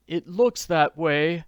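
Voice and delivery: put-on gloomy older man, slow and heavy. A narrator's reading. Jacob Aborn admits defeat, slow and gloomy. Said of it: "admitted gloomily".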